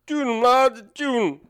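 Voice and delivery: loud, high-pitched Cajun caricature; mock Louisiana accent. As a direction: burst out like a cartoon Cajun. Imitating Louisiana talk.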